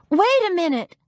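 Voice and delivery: high, loud call after him; yell. A narrator's reading. Narrator yells to Judge Haskins to wait. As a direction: shout to stop the judge. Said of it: "I yelled to Judge Haskins".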